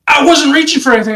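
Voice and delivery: high-pitched imitation of panicked denial; retold panicked denial. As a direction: blurt it high, as a mock-nervous excuse. Shaking man retold denying a reach.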